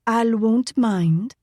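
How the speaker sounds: slow sweet reassurance; slow honeyed reply; sweetly. Acted. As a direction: coax them gently and slowly. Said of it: "Layla said sweetly".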